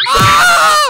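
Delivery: high, loud startled shriek; startled scream. Real, not acted. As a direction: scream as you fall in. Pushed into the hot tub.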